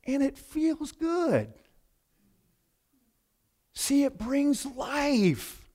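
contented. Retold or imitated. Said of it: "He goes".

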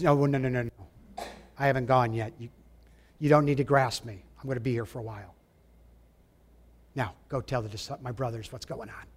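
softly, calming her down; quiet, fading toward a whisper; Jesus gently reassuring Mary, a hint of tenderness; gentle reassurance.

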